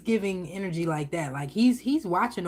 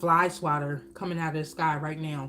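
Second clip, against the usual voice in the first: usual speaking voice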